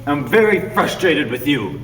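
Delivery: slow, hammed-up frustration; stage exasperation; mock consternation. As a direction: overact being fed up.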